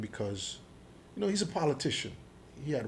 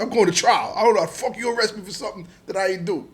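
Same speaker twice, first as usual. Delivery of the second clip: loud, raised-pitch retold protest; defiant outburst; 7 semitones higher, 8 dB louder than usual. Suspect vowing to go to trial, loud defiant retold protest. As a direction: push it out forcefully, speeding up.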